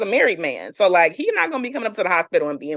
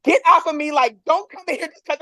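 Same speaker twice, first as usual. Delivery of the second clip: higher-pitched indignant "get off me"; retold angry protest; 7 semitones higher, faster than usual. Patient to staff touching her sore stomach, indignant retelling. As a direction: act out warding someone off, higher.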